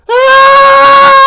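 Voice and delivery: high, loud panicked scream. Retold or imitated. Fleeing her car chase, a loud high scream. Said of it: "and I'm screaming".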